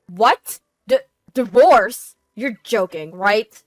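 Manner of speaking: loud, stammering shock; shocked disbelief. A narrator's reading. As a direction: blurt it out, stunned by divorce. Husband shocked by divorce.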